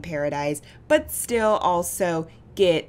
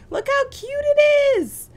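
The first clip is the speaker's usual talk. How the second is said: much higher, louder, slow delighted squeal; excited shriek; 7 dB louder, slower than usual. Real, not acted. Player showing viewers the cute bistro, squealing with delight. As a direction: squeal it out like you just spotted something adorable.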